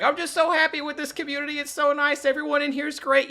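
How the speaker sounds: high-pitched, loud mock gushing; overly positive gusher. Mocking AI artists who are happy with their community, overly positive. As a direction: imitate relentlessly upbeat people in an exaggerated high voice. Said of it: "the most overly positive people you've ever seen".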